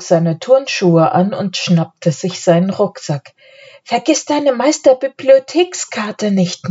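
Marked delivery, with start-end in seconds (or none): 3.8-6.7 higher-pitched reminder; gently admonishing, pitched up. A narrator's reading. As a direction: remind them like a little sister. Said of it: "mahnte Anne".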